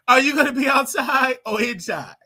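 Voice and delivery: high laughing question. Real, not acted. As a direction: ask it through bursts of laughter. Laughing while putting a question.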